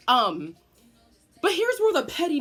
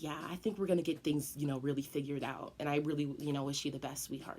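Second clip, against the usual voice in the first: much softer, syrupy and low; fake sweet teacher; 7 semitones lower, 12 dB quieter, faster than usual. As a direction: coo it condescendingly. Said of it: "And she's like".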